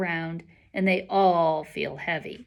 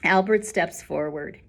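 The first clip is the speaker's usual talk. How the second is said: ordinary delivery, nothing marked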